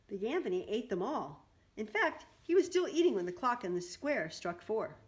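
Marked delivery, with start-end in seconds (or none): none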